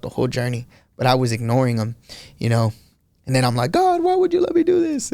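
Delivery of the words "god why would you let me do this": very high-pitched plea to God; high retold cry of why; pleading complaint. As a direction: imitate yourself wailing a question upward.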